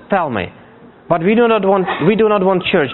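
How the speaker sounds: normal talking voice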